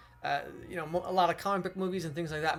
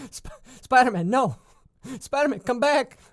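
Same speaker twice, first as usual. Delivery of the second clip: loud high call after someone; 7 semitones higher, 8 dB louder than usual. Pretend. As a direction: shout after him, pleading. Calling after Spider-Man.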